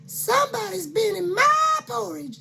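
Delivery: high fluting mama bear. Acted. Mama Bear protesting to her family, high and fluting. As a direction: squeal it up high like a fussy mother. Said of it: "said Mama Bear".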